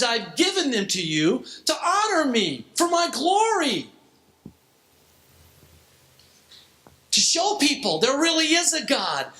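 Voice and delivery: high-pitched, emphatic pulpit declaration; impassioned preaching. Real, not acted. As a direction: preach it with rising conviction.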